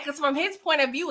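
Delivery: ordinary talk, nothing marked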